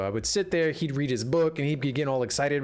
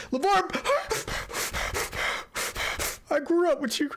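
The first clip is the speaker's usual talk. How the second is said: mock sob, much higher and straining; mock ugly cry. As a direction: pretend to blubber at your hero. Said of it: "Ugly cry".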